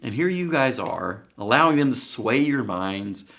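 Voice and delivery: looking down on the crowd; indignant high snooty voice; scornful Pharisees. Retold or imitated. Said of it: "they react and they say".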